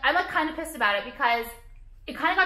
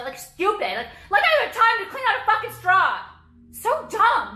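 high, loud exasperated outburst; real exasperation, pitched up and loud; exasperated rant; 10 semitones higher, 6 dB louder than usual. Real, not acted. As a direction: vent it, voice climbing. Ranting about reusable straws.

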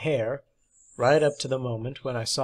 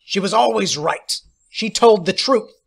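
higher, loud shout; furious yell; 7 semitones higher, 7 dB louder than usual. A narrator's reading. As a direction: shout her defence. Fight continues.

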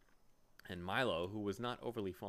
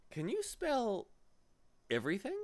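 higher-pitched, wondering question; admiring; 13 semitones higher, 6 dB louder than usual. A narrator's reading. Milo asking the clever bee, admiring higher voice. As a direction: ask in impressed wonder. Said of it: "asked Milo admiringly".

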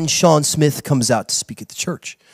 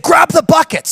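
sudden shout to grab the buckets; urgent order; 10 semitones higher than usual. Retold or imitated. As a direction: bark the order at the crew. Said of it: "He said".

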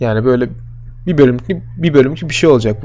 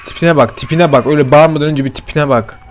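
ordinary talk

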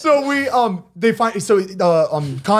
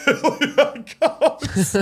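laughing; 6 semitones higher, much slower than usual. Real, not acted. Laughing while telling the story.

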